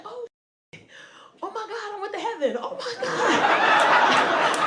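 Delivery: awed joy. Retold or imitated. Thinks she went to heaven.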